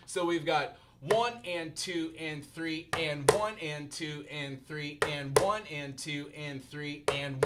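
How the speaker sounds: slow, even count of the beat; counting aloud. Shown as a demonstration. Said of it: "If that's too fast, just slow it down".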